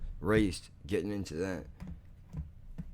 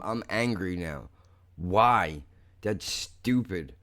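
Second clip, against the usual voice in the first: normal talking voice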